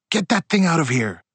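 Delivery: loud, high-pitched shouted order; angry yell. A narrator's reading. Narrator voices father glaring at Bibi, yelling angrily. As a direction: yell it as a furious command. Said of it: "and yelled".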